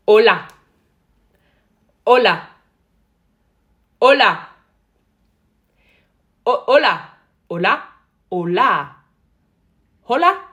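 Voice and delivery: very loud, short called greeting; calling out. Pretend. Someone practising a foreign greeting, calling out. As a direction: belt the greeting out, take after take.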